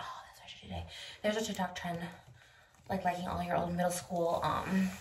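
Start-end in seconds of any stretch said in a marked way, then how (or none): 0.0-0.8 whispering. Real, not acted. Whispered aside.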